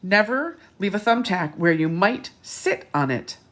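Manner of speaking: put-on lively read-aloud to children; bright picture-book narration; storybook reading. A narrator's reading.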